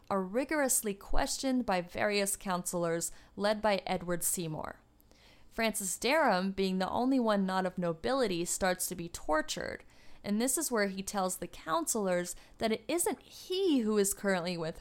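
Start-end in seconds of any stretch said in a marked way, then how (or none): none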